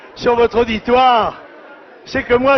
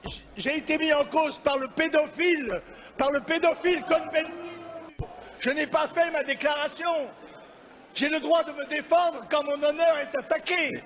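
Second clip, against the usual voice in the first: high-pitched, insistent protest; indignant protest; 4 semitones higher, 9 dB quieter than usual. Real, not acted. Speaker defending his honour before parliament, indignant protest. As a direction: call out your right to defend yourself.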